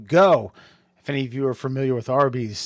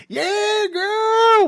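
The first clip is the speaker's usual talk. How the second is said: high squeaky mock catcall; mock a sleazy catcaller, high-pitched; 5 dB louder than usual. Mocking 1920s catcallers.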